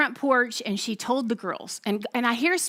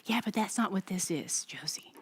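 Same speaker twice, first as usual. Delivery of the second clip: soft, low, near-whispered reply; hushed, understated sadness; retold tearful reply; 4 semitones lower, 9 dB quieter than usual. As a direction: gently retell a tearful answer, voice dropping away. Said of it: "and she's crying".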